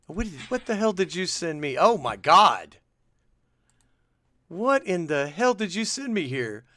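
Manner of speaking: high-pitched, incredulous reaction; shocked disbelief. Real, not acted. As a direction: react aghast at what you were sent.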